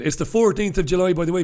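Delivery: normal talking voice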